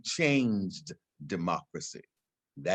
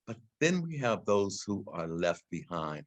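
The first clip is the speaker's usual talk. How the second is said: ordinary talk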